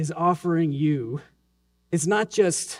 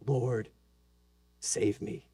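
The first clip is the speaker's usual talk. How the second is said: breathy whispered prayer, much softer; whispered plea; 8 semitones lower, 12 dB quieter, slower than usual. Pretend. Believer sinking, whispering a plea to the Lord, hushed. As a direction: barely breathe the words. When we say.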